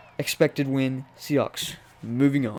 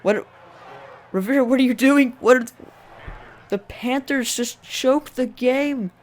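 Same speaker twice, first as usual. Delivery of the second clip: baffled outburst; 10 semitones higher than usual. Real, not acted. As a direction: yell at the coach in disbelief. Baffled yelling at coach.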